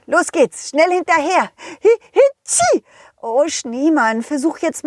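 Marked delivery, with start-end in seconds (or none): 1.8-2.8 high sneeze. Acted. Snowman sneezes. (hohes, langgezogenes Niesen)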